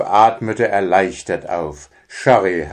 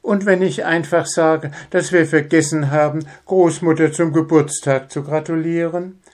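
much higher cautious mother's voice; timid hare mother; 8 semitones higher than usual. Acted. Hare mother plans safe distance, timid. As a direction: reason it out nervously.

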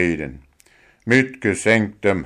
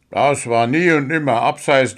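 higher, pushed bitter protest; 5 semitones higher, 4 dB louder than usual. A narrator's reading. Dwarf protesting the offside call bitterly. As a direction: argue the call back bitterly. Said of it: "sagte der Zwerg verbittert".

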